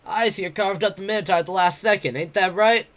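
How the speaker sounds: brash, jeering tavern boaster; cocky werewolf adventurer. Acted. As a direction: taunt him like a swaggering bully. Bete voiced in the story.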